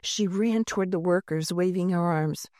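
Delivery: ordinary delivery, nothing marked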